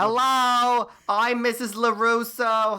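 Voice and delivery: high, put-on cheery mom calling out; sustained high sing-out, greeting everyone eagerly; Missus LaRusso. Mom waving across the car to the parents, high chirpy hello. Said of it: "Missus LaRusso is, like, a saint".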